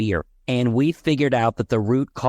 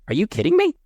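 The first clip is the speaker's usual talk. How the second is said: much higher-pitched incredulous question; retold disbelief; 13 semitones higher, faster than usual. Client answering the coach's promise about her child, retold disbelief. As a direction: imitate a client's astonished reaction.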